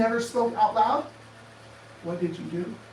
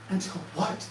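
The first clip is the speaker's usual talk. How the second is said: normal talking voice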